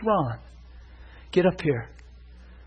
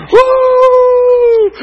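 long, piercingly high "woo"; imitated whoop; 16 dB louder than usual. Shirley leads the church like a band, imitated whoop.

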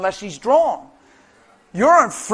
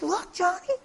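put-on soft, high child's voice; hushed, high delight; excited little girl; 10 semitones higher, 7 dB quieter than usual. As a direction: imitate a child showing off to a brother. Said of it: "she's like".

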